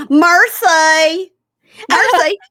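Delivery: high, loud exaggerated Merci; mocking Dave. Husband mocking her French in shops, loud high Merci. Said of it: "Dave would go".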